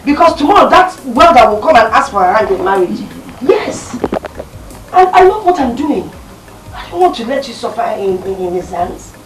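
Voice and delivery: father loudly rejecting the welder suitor; forceful insistence. Acted. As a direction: lay down the law to the family. Father insists on his plan.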